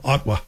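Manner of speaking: "Ottawa" said as OT-wa; dropped middle vowel. Shown as a demonstration. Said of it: "people leave out that extra vowel".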